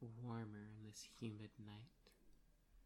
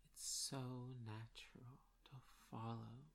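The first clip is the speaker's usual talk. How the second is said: ordinary delivery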